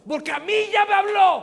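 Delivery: preacher's shout. Real, not acted. Preacher to congregation, climactic shout. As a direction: shout the refrain at the peak.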